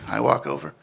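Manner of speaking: normal talking voice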